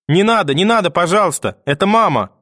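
put-on high-pitched, frantic child's plea; desperate pleading. A narrator's reading. Boy begs for his mother's gift, desperate cry. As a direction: beg at full urgency. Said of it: "кричал я".

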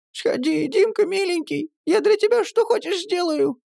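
very high, rushed pleading; the pale girl begs Dimka, very high and rushed; ardent pleading patter. A narrator's reading. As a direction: beg him hotly and fast. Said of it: "горячо затараторила бледная девчонка".

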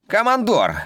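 high, outraged officer protest; indignant subordinates. A narrator's reading. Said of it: "возмутились другие подчинённые".

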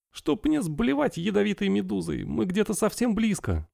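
high, hushed pirate wife; pirate woman voice. Acted.

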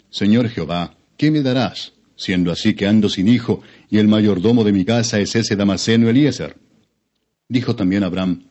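ordinary delivery

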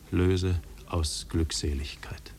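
soft, slow whisper. Acted. Man describing the silence inside him, slow whisper. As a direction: let it fade to a breathy whisper. Voice fades to breath.

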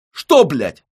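loud angry outburst; exploding. Acted. Fil explodes at Syava in a loud outburst. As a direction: blow up at him. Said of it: "взрываюсь я".

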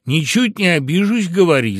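high, loud assurance; breezy insistence. A narrator's reading. Fox daring woodpecker to speak, loud insistence.

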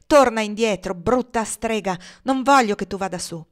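loud, forceful insult and command; angry order. A narrator's reading. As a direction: order her back, loud and cross. Legré angrily orders her back. (ordine rabbioso, a voce alta)

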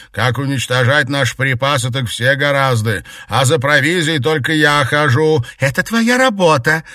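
loud, high bickering; shouted quarrel. A narrator's reading. Mistress and maid squabbling over provisions, angry. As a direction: trade indignant shouts across the house.

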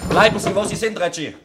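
loud threatening command at gunpoint; sharp command. Acted. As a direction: freeze him on the spot with a gun in hand. Threatening him with a revolver.

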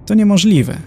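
indignant. A narrator's reading. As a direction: bristle with outrage at the very idea. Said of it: "oburzył się profesor Lung". (oburzone, dużo wyżej i głośniej)

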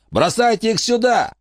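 high, sneering thug voice; mock the crazy old lady. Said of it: "сказал он".

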